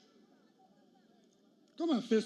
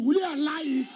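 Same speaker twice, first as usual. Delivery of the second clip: higher, loud, demanding "you" from the crowd; rally shout; 4 semitones higher, 3 dB louder than usual. Real, not acted. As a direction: shout it to make the crowd answer. Crowd not answering loudly enough.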